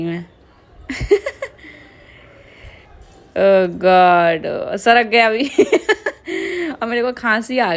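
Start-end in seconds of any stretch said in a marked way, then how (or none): 0.9-1.5 high, bright burst of laughter breaking out. Real, not acted. Laughing while eating. 5.5-6.8 high laughter bursting out mid-cough; laughing fit. Real, not acted.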